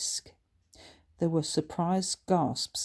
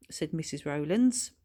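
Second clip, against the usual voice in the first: usual speaking voice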